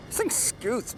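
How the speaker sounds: high, excited burst; thrilled exclamation. Real, not acted. Reviewer riding the mower on medium, high thrilled remark. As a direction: pitch it up, delighted by the speed.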